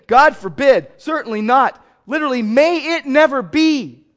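loud, high outraged denial; Paul's horrified denial, loud. Retold or imitated. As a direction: refuse it with horror. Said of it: "a sense of outrage".

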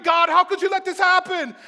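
high, loud cry to God; anguished questioning. Acted. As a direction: demand an answer from God. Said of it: "he's wondering".

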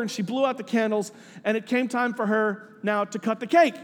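usual speaking voice

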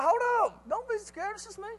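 hurriedly calm scared friends in a high voice; high-pitched "wait, it's only me", fading in volume; urgent reassurance. Acted. Jesus hurriedly calms scared disciples.

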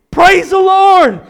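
high, loud, slow cry of relief; relieved praise. Acted. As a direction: burst out in joyful relief. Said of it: "you're just like".

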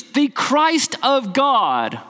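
slow, deliberate declaration; crisp clear answer. Retold or imitated. Peter answering Jesus, slow, unequivocal declaration. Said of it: "answers crisply, clearly, simply, unequivocally".

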